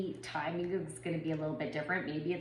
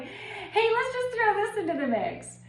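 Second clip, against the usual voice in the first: much higher, louder sing-song mock voice; mock cheery universe; 7 dB louder than usual. Vlogger voicing her period's timing as a mock cheery prank. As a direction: play fate cheerfully tossing in another problem. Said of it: "And so it's like".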